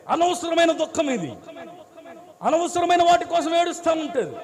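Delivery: loud, high preaching at full force; full-throated preacher's rebuke; fiery sermon rebuke. Acted.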